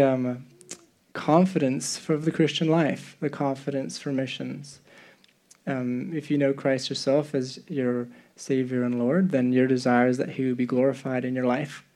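usual speaking voice

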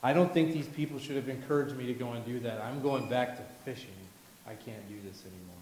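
low, soft, defeated muttering, fading away; discouraged giving up. Retold or imitated. Preacher voicing a disheartened Paul about to quit, quiet and defeated. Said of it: "throw in the towel there and say".

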